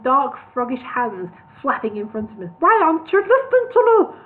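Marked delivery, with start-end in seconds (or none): 2.6-4.1 much higher, louder exasperated cry; agitated outburst. A narrator's reading. Solomon exasperated at Bingo, high and loud. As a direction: cry out in frustration. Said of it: "becoming quite agitated".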